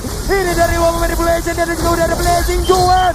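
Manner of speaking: loud, high hype call of a double kill; fighting game announcer. Real, not acted.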